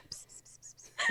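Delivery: unvoiced psst psst; whispered psst. Shown as a demonstration. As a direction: hiss psst into a pocket. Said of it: "talk to Lady Anne and go".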